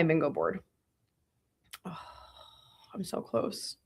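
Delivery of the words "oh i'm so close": whisper. Real, not acted. Checking bingo board, near win.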